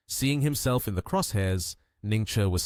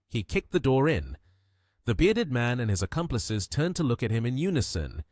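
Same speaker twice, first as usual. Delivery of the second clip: usual speaking voice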